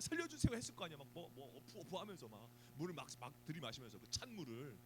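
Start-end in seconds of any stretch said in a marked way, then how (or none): 0.0-0.5 high, half-hearted plea for rescue; drowning man calling for rescue, mock panicked; mock cry for help.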